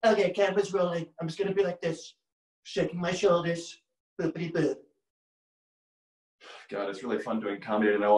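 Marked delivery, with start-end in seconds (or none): 0.0-3.8 cute, playful Trump impression; Fallon as Trump. Announcing the camera's rolling, a playful mock Trump voice. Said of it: "Fallon is much more cute and playful in his approach". 4.2-4.8 short, cutesy mock Trump; Fallon as Trump. Shaking shoulders on camera, a playful Trump impression. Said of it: "Fallon is much more cute and playful in his approach".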